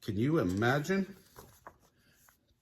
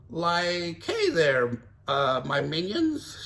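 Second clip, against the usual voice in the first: Shaggy voice; 5 semitones higher, 3 dB louder, slower than usual. Acted. Shaggy posing as king.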